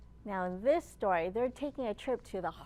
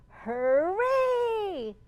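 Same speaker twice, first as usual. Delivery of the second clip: big high cheer, louder; 9 semitones higher, 9 dB louder than usual. Acted. Librarian leading kids, cheering hooray loud and high.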